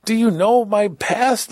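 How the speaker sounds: you can't believe what you're hearing; incredulous. Retold or imitated. Prisoner facing the class, high and incredulous. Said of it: "And I'm like".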